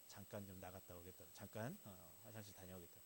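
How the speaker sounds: soft, low, polite excuse; quiet, low-pitched discreet murmur; gentle, discreet. Acted. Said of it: "부드럽게 하죠".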